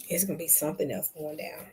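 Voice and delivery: normal talking voice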